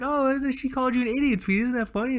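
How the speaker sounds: hint of a sweet mother cooing to sweetie; mock doting mom. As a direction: imitate a mom amused by her child. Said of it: "the mom's like".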